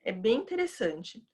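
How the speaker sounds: usual speaking voice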